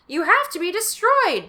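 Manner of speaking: high, loud, fierce; wild insistence. Acted. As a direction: repeat it with wild eyes.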